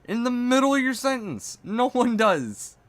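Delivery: high, loud exasperated rant; angry rant. Acted. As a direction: rant at him, fed up and shrill. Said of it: "I play them up a little bit".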